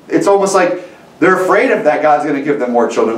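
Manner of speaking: ordinary talk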